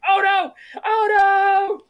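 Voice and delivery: high, loud, stretched-out holler; mock desperate wail. Quark wailing from the cell as sound is muted, mock desperate. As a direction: call out helplessly, hammed up. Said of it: "the Quark in the cell's going".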